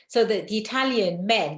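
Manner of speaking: ordinary talk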